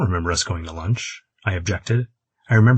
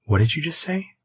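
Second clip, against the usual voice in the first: high-pitched, startled challenge; sharply disbelieving question; 8 semitones higher than usual. A narrator's reading. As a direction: demand it, taken aback. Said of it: "suddenly and very sharply".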